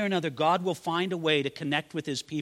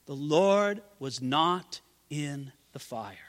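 slow refrain; 3 semitones lower, slower than usual. Acted. Sermon refrain, slowed.

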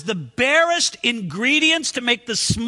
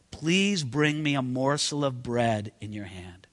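much lower and softer, gentle plea; kind request; 10 semitones lower, 6 dB quieter than usual. Shown as a demonstration. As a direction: ask it kindly, almost under the breath. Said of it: "I hope it was kind".

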